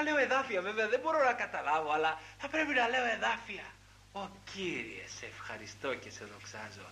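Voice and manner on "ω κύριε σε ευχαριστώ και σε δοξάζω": soft, lower whispered thanks to God; hushed prayer voice, much softer. Acted. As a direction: breathe the prayer out quietly.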